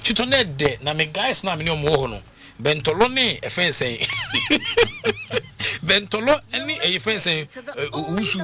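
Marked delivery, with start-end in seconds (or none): 4.1-5.8 a burst of high laughter; laughing out at the memory; real laugh. Real, not acted.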